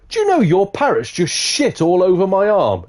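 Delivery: high-pitched acted outrage; indignant complaint. Customer complaining to pianist about his parrot, high indignation. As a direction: raise the pitch and protest right through.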